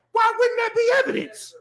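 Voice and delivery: high-pitched, incredulous protest; exasperated outcry. Real, not acted. Guest arguing rap lyrics count as evidence, exasperated outcry. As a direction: cry it out in disbelief, voice shooting up.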